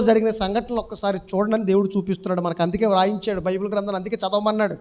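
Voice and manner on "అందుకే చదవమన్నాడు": ordinary talk, nothing marked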